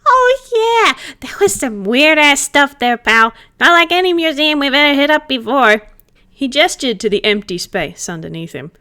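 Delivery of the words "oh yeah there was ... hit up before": high, loud, cheeky ghost voice; cheeky ghost buddy, excited; playful ghost chatting with his pal; playful spirit. Acted.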